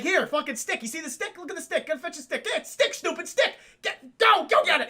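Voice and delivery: high, excited dog-owner urging; urgent play-fetch commands; mock fetch coaxing. Acting Newman playing fetch with dinosaur.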